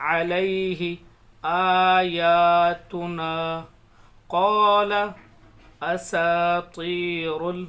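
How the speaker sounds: slow, loud, drawn-out tajweed recitation; measured recitation of verses; Quran recitation. Shown as a demonstration. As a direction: chant each word slowly with long pauses.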